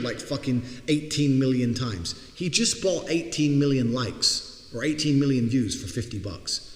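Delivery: ordinary talk, nothing marked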